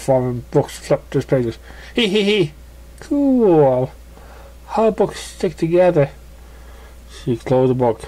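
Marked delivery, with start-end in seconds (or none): 1.9-2.5 high playful giggle. Acted. Pegasus giggling over a book, high. 3.0-6.1 amazed pony. Acted. Pegasus amazed by books.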